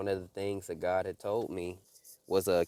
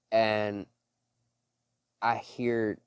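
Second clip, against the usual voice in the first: ordinary delivery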